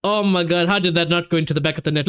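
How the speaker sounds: usual speaking voice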